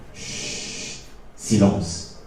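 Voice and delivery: slow shush sinking into a soft, low word; hushing. A narrator's reading. Narrator on the silent brother, hushed. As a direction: let it drop into a hush.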